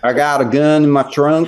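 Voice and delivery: a proper Southern drawl, slow and stretched out. Acted. A British guest tries a Southern accent for the hosts, slow drawl. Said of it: "That was a proper Southern drawl".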